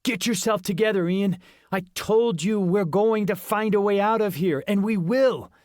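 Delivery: steady his panicking friend; firm friend. A narrator's reading. Justin voiced firmly.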